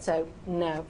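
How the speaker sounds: ordinary delivery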